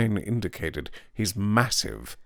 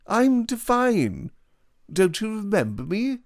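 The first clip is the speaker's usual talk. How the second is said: Devine's voice. A narrator's reading. Devine introduces himself.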